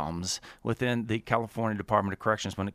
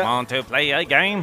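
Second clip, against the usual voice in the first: slightly wrong copied voice; 3 semitones higher, 5 dB louder than usual. Retold or imitated. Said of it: "that was pretty good".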